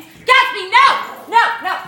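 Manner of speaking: telling off the dog Gatsby, loud high scold. Real, not acted. Scolding dog mid-review.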